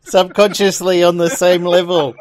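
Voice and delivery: said through laughter; loud laughing words. Real, not acted. Joking about ruining it.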